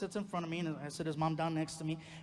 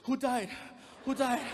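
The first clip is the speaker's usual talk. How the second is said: put-on kid alarmed; 8 semitones higher than usual. Kid voiced.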